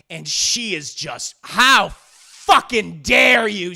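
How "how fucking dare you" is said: slow, loud outraged retort. Retold or imitated. Disgusted girl lashing out at the cold creature, loudly. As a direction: spit each word out in disgust.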